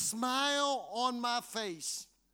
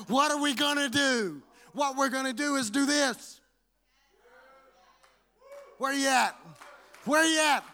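loud rising preacher call; 2 semitones higher, 6 dB louder than usual. Real, not acted. Preacher shouting out to the congregation, loud. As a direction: shout it out to the crowd.

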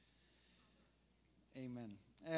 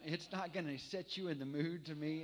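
normal talking voice

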